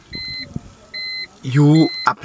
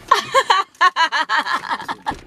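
high laugh. Real, not acted. Laughing at the prank.

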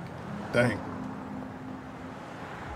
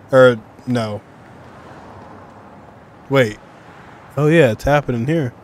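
loud, quick realization; suddenly getting it, louder; excited; 6 dB louder than usual. Real, not acted. Streamer realizing the cutscene is happening here, loud and excited.

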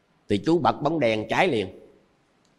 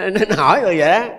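laughing; 7 semitones higher, 5 dB louder, much faster than usual. Real, not acted. Laughs after quoting the woman.

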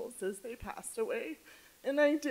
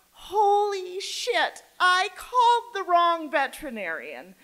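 a loud, slow, stunned gasp of dismay; shocked realization; 7 semitones higher, 10 dB louder than usual. Retold or imitated. Caller realizing she phoned the wrong vet, shocked and loud. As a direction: it suddenly hits you that you called the wrong clinic.

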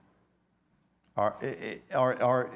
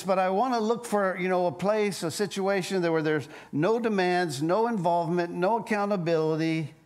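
higher, quick, fussy complaining; 5 semitones higher than usual. Acted. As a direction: play the picky believer who wants no demands. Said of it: "we could kind of become like Goldilocks Christians".

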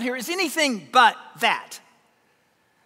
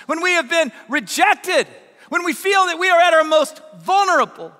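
impassioned preaching; 4 dB louder than usual. Real, not acted. Preacher listing worldly success to the congregation, impassioned. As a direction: ring it out, stretching the key words.

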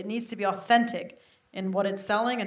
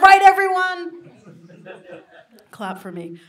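calling out to crowd; 8 semitones higher, 10 dB louder than usual. Acted. Demanding applause from audience.

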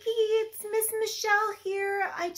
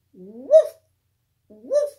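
lower, loud woofs; low dog barks; 5 semitones lower, 11 dB louder than usual. Acted. Teacher barking for Biscuit, low and loud. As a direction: bark deep and loud like a bigger dog.

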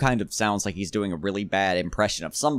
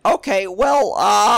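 high, loud fumbling "Okay, well, uh"; loud, high flustered stall; mock clueless writers; 10 semitones higher, 8 dB louder, slower than usual. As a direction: play the stumped writers with nothing to say. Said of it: "And then they went".

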